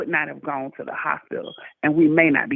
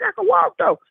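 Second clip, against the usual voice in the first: high and louder, insisting; retold protest; 6 dB louder than usual. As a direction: raise the voice to insist. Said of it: "I was like".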